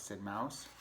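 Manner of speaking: plain reading voice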